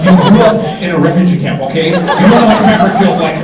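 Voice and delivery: loud exasperated retort. Retold or imitated. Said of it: "I'm like".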